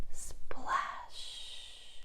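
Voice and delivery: breathy whispered sound word; whispered splash. A narrator's reading. Storyteller whispering the troll's splash.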